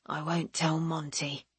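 gentle teasing with a smile; soft smile. Acted. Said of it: "the soft smile in his voice".

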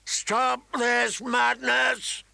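slow, high, booming royal shout; king's commanding roar, words drawn out; booming battlefield voice. A narrator's reading. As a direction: bellow it across a battlefield. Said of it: "He used that voice now".

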